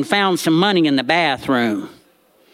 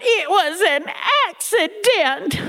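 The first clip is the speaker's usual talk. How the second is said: high, slow, teary protest; granny sobbing; 12 semitones higher, slower than usual. Retold or imitated. Granny crying her excuse to her family, high and slow. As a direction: whimper it like a caught child. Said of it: "And Granny cried".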